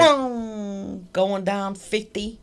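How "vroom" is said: long drawn-out vroom; playful mock engine noise; imitated engine zoom. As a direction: zoom away like a racing toboggan. Imitating toboggan racing downhill.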